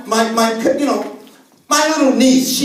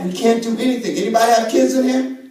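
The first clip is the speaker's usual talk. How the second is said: usual speaking voice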